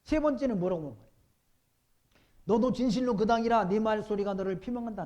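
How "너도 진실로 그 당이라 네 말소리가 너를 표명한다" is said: loud, higher proclaimed accusation; preached quote. Acted. Pastor re-reads the bystander's accusation, loud preaching. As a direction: fling the accusation at Peter.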